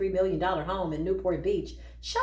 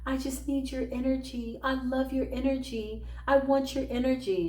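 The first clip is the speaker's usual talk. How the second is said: needy gushing; 5 semitones higher than usual. Retold or imitated. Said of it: "was like".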